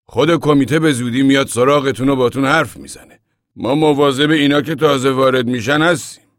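loud, high, self-important explanation; proud. A narrator's reading. Old man explains the camp committee to the newcomer, proudly. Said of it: "مرد با غرور گفت".

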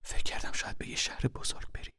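urgent whisper in the dark; hushed whisper. Acted. Mother whispering warnings to her hiding son. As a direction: keep it breathy and secret.

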